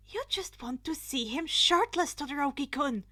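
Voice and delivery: flustered loud accusation; indignant accusation. Acted. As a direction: call him out, flustered and loud. Yaoyorozu scolding Todoroki.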